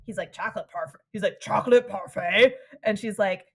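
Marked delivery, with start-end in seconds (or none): 1.4-2.6 louder, baffled mock question with a rise; loud, puzzled put-on voice; clueless character's question. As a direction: repeat it as if you've never heard the words. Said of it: "clearly has no fucking clue what it is".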